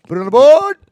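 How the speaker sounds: booming radio call; announcer home run call. Retold or imitated. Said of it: "That's it, Howie Rose".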